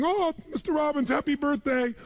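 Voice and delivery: high-pitched, gushing maid impression. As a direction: coo with over-the-top delight at the boss. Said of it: "And my maid, she's back at home in the castle going".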